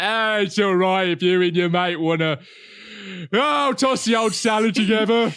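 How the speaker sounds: loud, high, hammed-up British character voice; easygoing Brit caricature. Brit caricatures his own nation shrugging at shame, loud put-on voice. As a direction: holler it like a caricatured permissive countryman. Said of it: "maybe we're just like".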